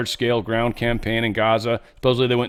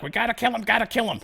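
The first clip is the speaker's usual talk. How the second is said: mock war frenzy; 11 semitones higher, faster than usual. Mocking war hype chant.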